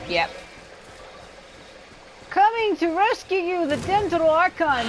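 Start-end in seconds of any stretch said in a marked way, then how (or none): 2.3-4.9 mock heroic cry. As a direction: proclaim yourself the Dental Archon like a cartoon hero. Mock heroic self-announcement.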